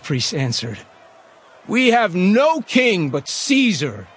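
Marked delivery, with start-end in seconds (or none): 1.7-4.1 high, slow, emphatic cry; defiant declaration. Acted. Chief priests answer Pilate, defiant declaration. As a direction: declare it loudly, stretching each word.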